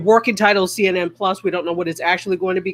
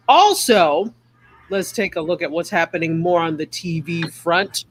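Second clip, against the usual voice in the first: usual speaking voice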